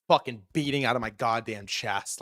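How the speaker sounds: ordinary delivery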